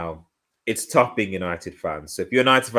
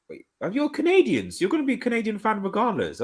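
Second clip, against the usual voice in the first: high, fast surprised question; 13 semitones higher than usual. Real, not acted. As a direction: suddenly caught off guard, voice jumping up. Realizes chat friend's nationality.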